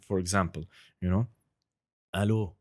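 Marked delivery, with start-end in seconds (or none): 2.1-2.5 lightly put-on Lebanese Arabic greeting; casual Lebanese phone chat; Lebanese accent. Shown as a demonstration. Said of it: "the Lebanese accent".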